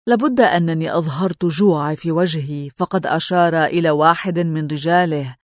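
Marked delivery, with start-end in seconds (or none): none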